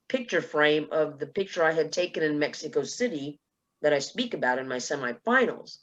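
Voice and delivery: usual speaking voice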